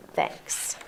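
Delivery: breathless soft whisper of gratitude; whispered thanks. Acted. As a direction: barely voice it, moved. Bear finally touched.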